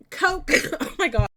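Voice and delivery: coughing on water; sputtering after a drink went down wrong; choking cough. Real, not acted. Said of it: "Water went down the wrong way".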